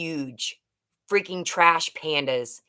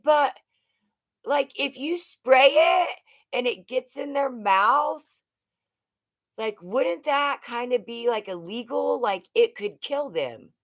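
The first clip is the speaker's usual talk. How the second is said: high-pitched, questioning objection; imitated stepson; 7 semitones higher than usual. Stepson questioning wasp spray as defense, imitated high doubtful voice.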